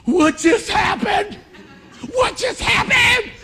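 slow, very high, loud stunned shout; imitating excited son. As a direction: shout it like a stunned fan, drawing it out. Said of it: "And he went".

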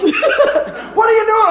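mock yell at the daydreaming outfielder; teammates yelling. Teammates yelling at the boy who missed the ball, mock yell. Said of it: "Your buddies".

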